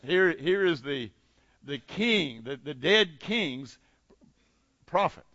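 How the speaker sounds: usual speaking voice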